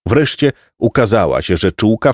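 plain reading voice

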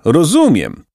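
mild eager call. Acted. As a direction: call out as it dawns. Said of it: "zawołał Jacek".

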